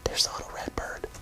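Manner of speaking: soft unvoiced whisper. Real, not acted. Whispering to the child about the bird. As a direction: point it out in a whisper.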